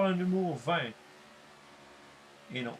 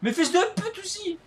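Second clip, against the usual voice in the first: furious yell; 6 dB louder than usual. Real, not acted. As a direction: scream at the game in rage. Rage at the game. (cri de rage)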